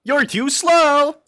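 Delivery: high, loud, drawn-out cartoon taunt; Sonic. Streamer testing if he sounds like Sonic, taunting loudly. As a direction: copy the game clip at full volume. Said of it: "That doesn't sound like me".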